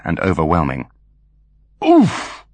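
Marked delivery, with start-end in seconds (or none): 1.8-2.5 sharp pained oof, pitched up; grunt. Acted. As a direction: grunt as if hit. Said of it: "grunted Foley".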